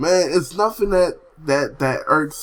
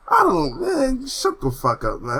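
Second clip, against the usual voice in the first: high, drawn-out exasperation; high-pitched weary dismissal; exasperated; 8 semitones higher than usual. Acted. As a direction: whine it high, fed up with them. Fed up with hateful commenters.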